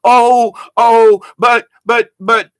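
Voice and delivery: loud, high flustered sputter; hammed-up spluttering with no comeback; mock sputtering. Critics mocked in loud, high flustered sputtering. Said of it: "all they can go is".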